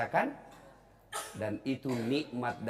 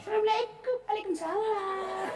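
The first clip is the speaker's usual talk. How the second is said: put-on swooning boy voice. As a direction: ham up a lovesick boy. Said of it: "ada cowok juga yang begitu".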